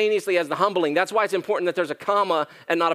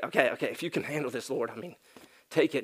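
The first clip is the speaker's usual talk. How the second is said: softer, lower, limp giving-up; half-hearted prayer; 4 semitones lower, 7 dB quieter, faster than usual. Pretend. Pastor mimicking a timid believer's prayer, mild. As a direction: hand it over weakly, unsure.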